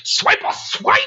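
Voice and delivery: very high rapid-fire cartoon chant; squealed sneaky patter; Swiper. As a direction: imitate a scheming fox sneaking in. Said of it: "He's like".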